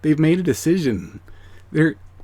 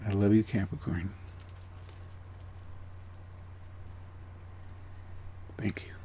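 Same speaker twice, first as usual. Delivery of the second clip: very soft, lower, almost whispered; real, hushed emotion; 3 semitones lower, 13 dB quieter than usual. Real, not acted. Reader walking Capricorn into heaven, hushed and emotional. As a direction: speak softly and tenderly, overcome by it. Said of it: "This is making me emotional".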